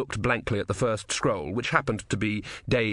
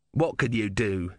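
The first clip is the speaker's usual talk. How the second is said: usual speaking voice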